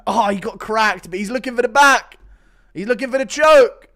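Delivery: excited, rising live play-by-play; fight commentary. Acted. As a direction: call the fight as it builds, loud at the peaks.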